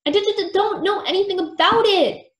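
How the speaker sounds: high, loud flustered protest; stutter, then insist emphatically; stammering insistence. A narrator's reading. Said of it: "Billy insisted".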